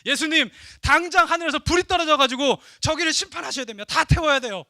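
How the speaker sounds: loud, high furious outburst; angry demand. Retold or imitated. John angrily begging Jesus to burn the village, loud. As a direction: demand fire from heaven in a rage. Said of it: "화가 났어요".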